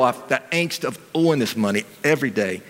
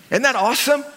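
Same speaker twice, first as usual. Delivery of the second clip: rising joy; 7 semitones higher, slower than usual. Real, not acted. Preacher exulting over God's yes, rising joy. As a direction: build into rapturous yeses.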